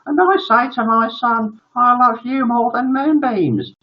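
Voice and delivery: high, gushing mummy voice; doting mother. Acted. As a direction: declare adoring love to a son. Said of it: "turned to her son and said".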